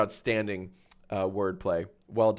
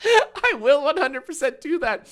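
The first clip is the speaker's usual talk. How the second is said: laughing; 14 semitones higher, 4 dB louder than usual. Real, not acted.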